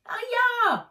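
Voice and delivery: high, loud cry of surprise; pained yelp. Acted. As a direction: yelp as she crashes down. Character crashes off camera.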